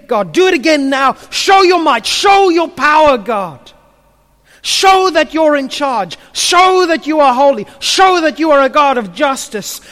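high, insistent pleading with God; impassioned prayer. Acted. Habakkuk begging God to act again, impassioned and pleading. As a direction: cry out each petition with rising urgency, easing at the end. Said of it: "he relives it poetically".

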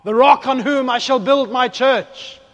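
loud, high, drawn-out declaration; booming proclamation. Acted. Preacher voices Jesus naming Peter the rock, booming proclamation. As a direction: thunder it out slowly, high and ringing.

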